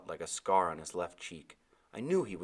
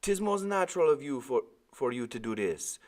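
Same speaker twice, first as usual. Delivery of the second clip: higher, louder island sailor voice; old West Indian sailor; 8 semitones higher, 4 dB louder than usual. A narrator's reading. Sailor explaining the boy's head injury. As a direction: speak as the big old deckhand.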